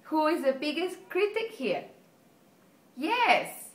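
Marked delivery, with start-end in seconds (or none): 3.0-3.7 eager yes. Real, not acted. Answering her own quiz question.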